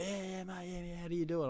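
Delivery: mock Kiwi bloke jumping at free tickets; excited Kiwi accent. Imitating Kiwi fans offered free tickets, eager Kiwi accent. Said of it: "They got the accent".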